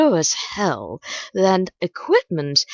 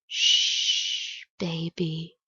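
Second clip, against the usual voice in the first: soft hushing; 5 dB quieter, much slower than usual. Acted. Poem's killer soothes the bleeding lover, hushed. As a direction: hush them softly, dropping very quiet.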